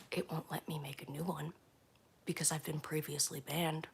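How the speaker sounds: hushed unvoiced whisper. Real, not acted. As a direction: whisper it, barely voiced. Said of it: "whispering the account ban".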